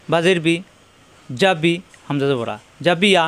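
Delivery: ordinary talk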